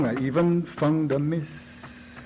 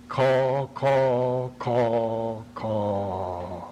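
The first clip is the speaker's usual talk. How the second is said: crow caws; 5 semitones lower, much slower than usual. Acted. Poet imitating the crows that money buys, fading caws. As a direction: caw like a crow, letting each one drop away. Crows cawing over Babylon.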